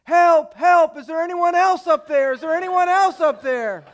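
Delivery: high, loud frantic yell for help; panicked shout. Acted. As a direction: scream for anyone but God to answer. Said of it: "To which the man replies".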